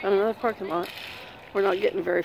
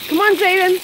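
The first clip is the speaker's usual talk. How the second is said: calling out; 9 semitones higher, 6 dB louder than usual. Real, not acted. Grandparent rounding up kids outdoors, calling out.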